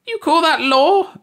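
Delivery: very high, loud, furious outburst; raging. A narrator's reading. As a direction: rage at the ruling. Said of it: "Ursi raged".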